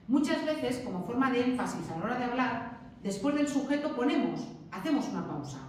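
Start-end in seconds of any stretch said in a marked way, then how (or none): none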